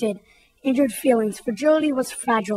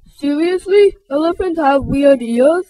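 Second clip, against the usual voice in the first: wondering; 2 semitones higher, 4 dB louder than usual. Acted. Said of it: "wondered Rudy".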